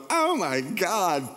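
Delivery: high, slow drawn-out cry; falling bird panic. Pretend. Baby bird tumbling out of the nest, high drawn-out cry. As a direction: wail it as you tumble down.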